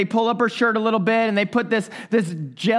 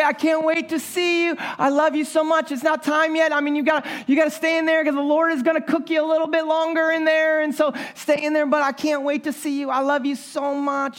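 higher, loving words to the unborn son; tender talk to baby; 6 semitones higher than usual. Retold or imitated. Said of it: "I would always say".

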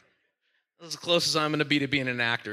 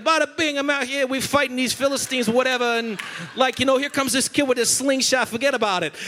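higher hammed-up New Jersey accent; 6 semitones higher than usual. Pastor voicing a Philistine soldier in a hammed-up New Jersey accent. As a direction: play a New Jersey tough guy, hammed up. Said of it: "I just gave him a New Jersey accent".

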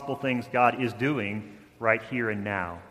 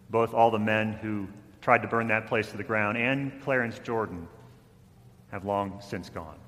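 ordinary delivery

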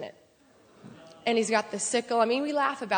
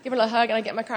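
usual speaking voice

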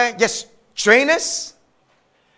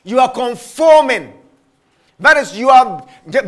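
normal talking voice

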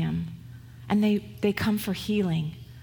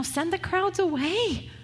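pleading disciples; 8 semitones higher than usual. Retold or imitated. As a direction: drag it out like a hungry whine. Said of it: "So they say to Jesus".

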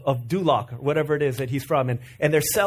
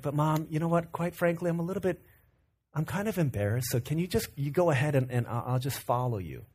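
embarrassed murmur; 3 semitones lower, 7 dB quieter than usual. Retold or imitated. Embarrassed teen asks quietly.

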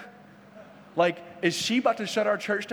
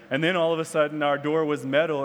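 ordinary talk

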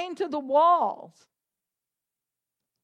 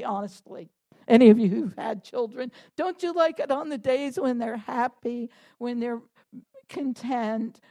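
ordinary delivery

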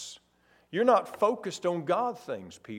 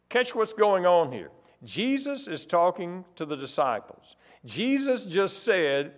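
ordinary talk, nothing marked

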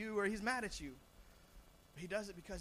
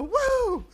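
put-on high, loud toddler whoop; dad imitating son Judah, loud high whoop; imitating child's woo; 17 dB louder than usual. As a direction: belt out a kid's joyful woo. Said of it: "And he'll go".

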